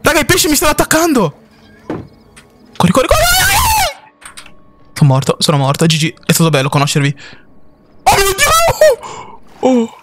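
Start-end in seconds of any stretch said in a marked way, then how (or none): 0.0-1.4 high, frantic alarm; real panic, pitched high; panicked cries. Real, not acted. Attacked by fish in water. 1.9-3.7 high screaming run, run; real panicked yell; frantic shout. Real, not acted. Fleeing a monster. 8.0-10.0 high, slow drawn-out wail; real dread, pitched high; horrified wail. Real, not acted. Monster jumpscare.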